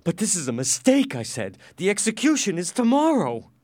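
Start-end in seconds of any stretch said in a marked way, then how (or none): 0.0-1.1 high, louder protest; alarmed protest. Acted. Prisoner protests his execution. 1.8-3.5 loud, high-pitched objection; alarmed protest. Acted. Prisoner protests his execution.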